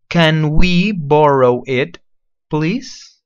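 "Can we borrow it, please?" very slow, rising on please; slow model phrase. Shown as a demonstration. Teacher slowly modeling "Can we borrow it, please?". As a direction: drag it out so they hear each word. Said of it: "Listen to the pronunciation first".

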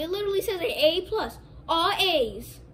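normal talking voice

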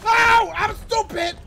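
high, very loud self-scolding shout; alarmed yell. Real, not acted. Gamer realising a blunder mid-attack, alarmed yell. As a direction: scream it at your own blunder. Sudden mistake in game.

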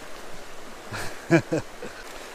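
soft, breathy run of chuckles. Real, not acted. Angler after losing a fish, soft chuckle. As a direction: laugh it off quietly.

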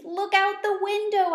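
desperate little plea to look; pleading squeak. Acted. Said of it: "I squeaked".

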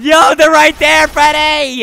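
loud, high, drawn-out warning; urgent shout. Pretend. Player warning Freddy they're right there, urgent shout. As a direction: call it out urgently. Warning partner during chase.

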